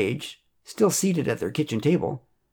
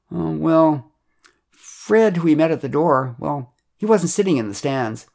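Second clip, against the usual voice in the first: higher, hedging character voice; awkward, pitched-up explaining; sheepish confession; 5 semitones higher, 3 dB louder than usual. A narrator's reading. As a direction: stall before owning up. Chuck sheepishly begins his confession.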